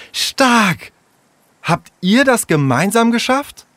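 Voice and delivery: be wowed by what they built; impressed. Acted. Little sister admires the friends' ramp, high and amazed. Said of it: "Jule war beeindruckt".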